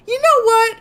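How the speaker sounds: high, loud, worked-up outburst; laughing mock rage at the show. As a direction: fake a sudden blowup. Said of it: "I'm like".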